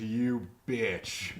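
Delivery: cold villain voice, lightly imitated; a hint of a sneering villain; Albert Wesker voice. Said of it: "he does his Albert Wesker voice".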